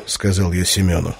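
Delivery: ordinary delivery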